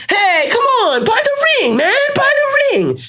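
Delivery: very high, loud mock pleading; screaming salesman. Pretend Tiffany seller pushes an engagement ring, screaming. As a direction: badger the shopper at the top of your voice. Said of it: "scream at people on the street".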